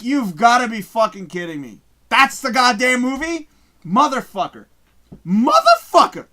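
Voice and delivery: incredulous outburst. Retold or imitated. Streamer reliving hearing the line while cooking, mock outraged yell. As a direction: let the shock boil over into a yell. Retelling disbelief at finding movie.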